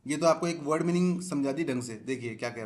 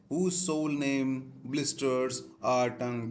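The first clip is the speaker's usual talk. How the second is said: very slow reading; much slower than usual. Shown as a demonstration. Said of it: "teacher reads text slowly".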